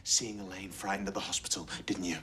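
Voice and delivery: low, hushed taunt; menacing whisper. Acted. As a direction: lean in and whisper the accusation. Prosecutor needles defendant in court.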